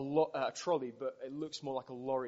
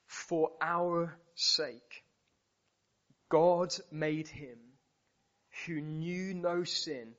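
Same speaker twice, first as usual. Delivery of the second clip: slow, deliberate preaching, each phrase set apart; slow scripture recitation; 3 semitones higher, slower than usual. Acted. Preacher reciting Second Corinthians to the congregation, slow and weighty. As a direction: lay out the verse word by word.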